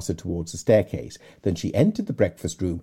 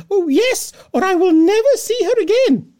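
very high, loud, dramatic wail; the mother insisting at full volume; Mrs Bennet; 9 dB louder than usual. Acted. Mrs Bennet voiced in reading.

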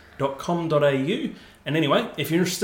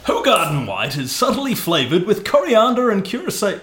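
higher-pitched, projected radio announcer; 6 semitones higher, 4 dB louder than usual. Pretend. Host reading the beer blurb to co-host, radio voice. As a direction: read it like a hammed-up radio presenter. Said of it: "Radio voice".

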